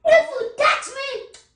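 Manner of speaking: high, slow angry warning; threatening yell. Acted. Husband warning wife not to touch, threatening yell.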